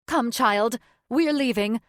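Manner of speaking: curt command. Acted. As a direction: sweep her daughter out, sharply.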